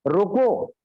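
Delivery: "रोको" alone, followed by a long pause; full stop after रोको. Shown as a demonstration. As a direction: cut off after "रोको" as a complete command. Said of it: "full stop, अल्प विराम दे करके". ("रोको" पर पूर्ण विराम)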